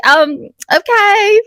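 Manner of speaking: high slow bemused um okay; puzzled artist. Retold or imitated. Artist reacting to client liking pain, slow bemused mock voice. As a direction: sound baffled by her.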